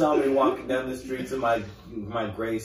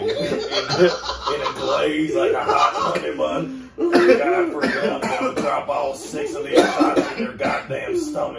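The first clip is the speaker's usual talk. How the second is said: yelling high and loud, half laughing; mock furious shout; 6 semitones higher, 6 dB louder than usual. Podcast host yelling a joke threat at his friend, shouting. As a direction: rant it at the top of your voice.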